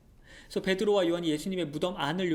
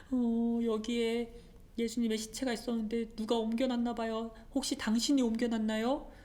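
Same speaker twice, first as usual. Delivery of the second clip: tearful; 6 semitones higher than usual. Acted. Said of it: "막 울었어요".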